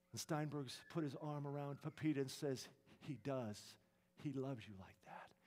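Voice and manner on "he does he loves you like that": soft, low, comforting voice; tender reassurance. Acted. Painter comforts crying Pepita with arm around her, tenderly. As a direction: reassure gently, hushed and low.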